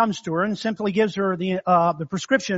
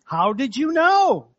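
higher, slow puzzled ask; surprised question; 5 semitones higher, slower than usual. Retold or imitated. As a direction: sound genuinely baffled. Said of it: "Well, yes, I am".

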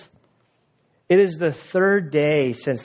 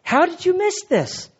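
disbelieving disciples; 9 semitones higher than usual. Retold or imitated.

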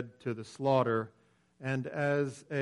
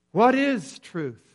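slow, higher, earnest plea to learn; louder, slow and sincere question; sincere asking; 7 semitones higher, 8 dB louder, slower than usual. Retold or imitated. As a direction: ask What is truth? as if you truly want to know. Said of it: "Pilate is not here saying, "Please explain to me".

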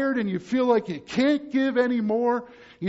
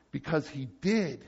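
quiet; 6 semitones lower, 5 dB quieter than usual. Acted. Quiet answer after loud questions.

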